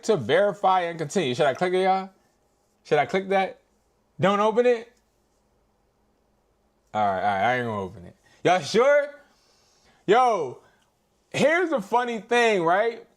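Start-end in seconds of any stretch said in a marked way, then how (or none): none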